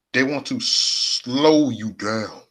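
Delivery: slow, elongated delivery with SLOW held long; drawn-out slow. Shown as a demonstration. Streamer warning chat about trolls, drawn-out slow. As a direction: stretch out SLOW, dragging the line.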